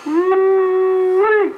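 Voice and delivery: high, drawn-out woo-wee; delighted whoop. Real, not acted. Husband whooping with joy over his late wife, high and long. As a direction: let the joy burst out.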